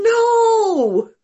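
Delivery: loud, high, exclamatory NO; a forceful high no to doubting students; emphatic no. Real, not acted. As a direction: shout it down, insistent. Teacher rejecting students' doubt.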